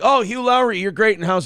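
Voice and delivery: high, admiring fan voice; gushing fan. Acted. Fan complimenting Hugh Laurie on House, gushing.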